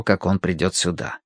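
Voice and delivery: plain reading voice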